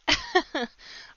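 sudden high burst of laughter; loud laugh. Real, not acted. Laughs at own tired choices.

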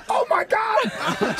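high, slow, drawn-out gasp of shock; high drawn-out oh my God. Retold or imitated. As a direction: stretch it out in disbelief. Realizing the breeze, shocked.

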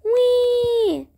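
ordinary delivery

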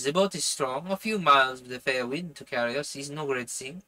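put-on French-accented character voice; earnest plotter with a French accent. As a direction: read the dialogue in a French accent. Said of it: "I'm doing this French accent".

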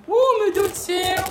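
ordinary talk

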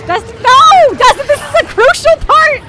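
loud high yell at a friend; frustrated yell. Real, not acted. Player yells at friend Dustin who sabotages her, frustrated yell. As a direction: shout him down, half laughing.

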